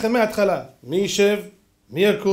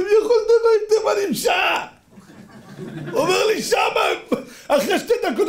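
laughing mocking disbelief; 14 semitones higher than usual. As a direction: crack up at the idea of an hour-long speech. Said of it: "הוא צחק".

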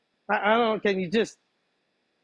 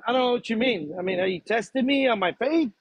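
ordinary delivery

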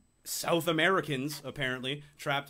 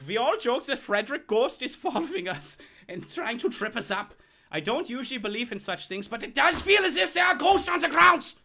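quote read in a put-on voice; 10 semitones higher, 5 dB louder than usual. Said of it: "If you can do a Bolivian accent".

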